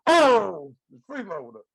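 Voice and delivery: loud "Oh" then a dropped "freeloader"; Ed Reza impression. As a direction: do Ed Reza scolding the dog. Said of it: "let me do Ed Reza".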